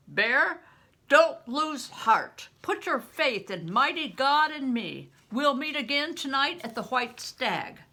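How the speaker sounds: loud, high call from atop the wall. Acted. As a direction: call back a promise while fleeing. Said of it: "the priest hesitated and called".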